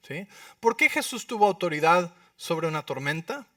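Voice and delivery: ordinary talk, nothing marked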